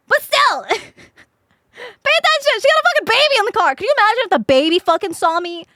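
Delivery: high, loud and fast through laughter; cracking up while scolding her; squealing laughter mid-rant; laughing rant. Real, not acted. Laughing rant about the crash.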